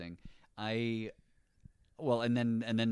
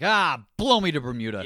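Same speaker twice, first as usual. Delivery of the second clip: mock angry curse; 7 semitones higher, 15 dB louder than usual. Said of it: "every time I'm angry".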